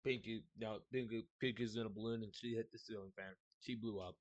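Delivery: soft deadpan lament; quiet. Real, not acted. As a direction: mutter it low and dismayed.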